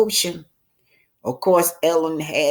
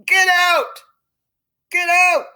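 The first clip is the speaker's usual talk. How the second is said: high, loud barked order; Harry shouting Melinda out of the room; angry shout; 12 semitones higher, 6 dB louder than usual. A narrator's reading. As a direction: throw her out with your voice.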